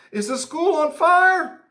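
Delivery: high, loud drawn-out yell; yelled question. Acted. Kid yelling to friend Timmy, alarmed shout. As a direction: holler it across a parking lot. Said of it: "and I yelled".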